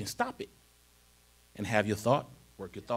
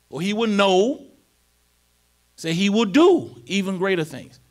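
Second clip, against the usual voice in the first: loud, high, stretched preaching climax; whooping sermon peak, drawn out on faith in me; preaching at full force; 8 semitones higher, 7 dB louder, slower than usual. Acted. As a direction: build it and hammer the words.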